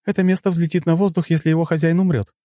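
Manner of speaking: high, urgent female character voice; alarmed female companion. A narrator's reading. Female companion warning of an explosion, alarmed.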